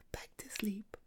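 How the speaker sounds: whispered back to sleep; quiet whispered lull; soft whisper. Acted. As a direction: hush the kitten down.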